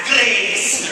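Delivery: "Grace" with a rolled Scottish r, said big; Scottish accent. Shown as a demonstration. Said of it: "you should say it with that Scottish accent".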